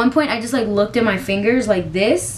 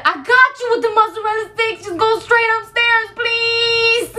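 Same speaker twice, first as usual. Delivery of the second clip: cousin reassuring; 12 semitones higher than usual. Retold or imitated. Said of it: "She said".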